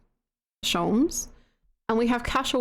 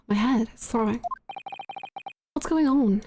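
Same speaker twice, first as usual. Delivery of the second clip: ordinary talk, nothing marked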